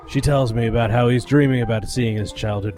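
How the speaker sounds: hard-boiled detective narrator; low, world-weary gumshoe voice; noir narration. Acted.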